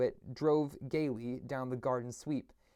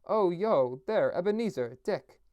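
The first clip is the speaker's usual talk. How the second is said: rich, jovial, higher and louder hail; a fat, merry old master calling out; Fezziwig's jovial call; 5 semitones higher, 5 dB louder than usual. Acted. Old master hailing his two apprentices, jovial and loud. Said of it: "oily, rich, fat, jovial voice".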